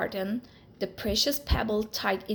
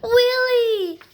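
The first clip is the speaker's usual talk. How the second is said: much higher, loud shout of joy; a mouse overjoyed to find his friend; joyful cry; 13 semitones higher, 10 dB louder than usual. Acted. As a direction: cry out the name in delight. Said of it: "cried Alexander".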